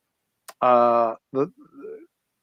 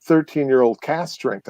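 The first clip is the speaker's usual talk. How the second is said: usual speaking voice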